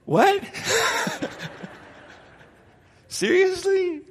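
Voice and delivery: high-pitched incredulous outburst with a laugh; acted shocked disbelief. Customer reacting to the pants' price, shocked disbelief. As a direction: blurt it out in mock shock.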